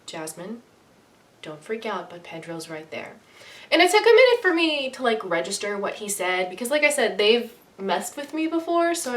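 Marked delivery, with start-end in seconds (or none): 0.1-3.1 hushed, careful heads-up; calm quiet warning. Retold or imitated. Coworker quietly warning her not to panic. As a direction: break it gently so she stays calm. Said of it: "calmly and slowly walks up to me".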